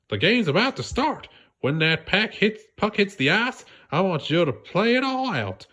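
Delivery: lightly voiced Sandy encouraging SpongeBob; cheerful Sandy. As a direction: reassure your friend brightly.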